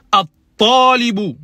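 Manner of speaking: at-talibu said slowly with a doubled t; slow model pronunciation. Shown as a demonstration. Teacher slowly models at-talibu for students. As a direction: stretch out at-talibu, the t held. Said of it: "On dit".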